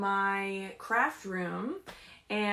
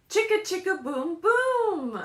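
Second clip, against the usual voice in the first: higher, louder, slow title call; excited title; 9 semitones higher, 9 dB louder, slower than usual. Acted. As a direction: announce the title with a big show. Announcing the book title.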